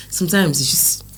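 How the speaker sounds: normal talking voice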